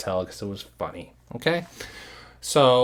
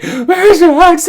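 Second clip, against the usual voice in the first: very high, loud, slow mock wail; mock crying; 12 dB louder, slower than usual. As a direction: whine it drunkenly. Imitating her crying.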